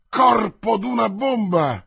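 angry outburst. A narrator's reading. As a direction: explode with rage at the news. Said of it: "con un impeto di collera". (imprecazione furiosa, forte e acuta)

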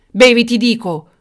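loud, high tyrant's shout; angry, commanding yell; yelled order. Acted. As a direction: bellow the order. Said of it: "urlò il tiranno".

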